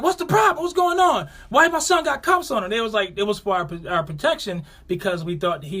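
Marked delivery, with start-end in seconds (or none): 0.0-2.7 high, loud demanding voice; high angry mother confronting police. Retold or imitated. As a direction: storm in demanding answers. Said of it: "you're like".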